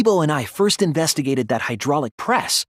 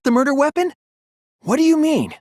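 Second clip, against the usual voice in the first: much higher, thrown by the reveal; startled confusion; 13 semitones higher, 3 dB louder, faster than usual. Acted. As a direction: caught off guard, demanding an answer. Sudden twist during class trial.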